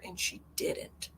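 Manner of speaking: soft, slow whisper. Real, not acted. Complaining that the surgeon removed her ovary, in a slow whisper. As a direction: let it drop to a whisper. Bitter aside about the surgeon.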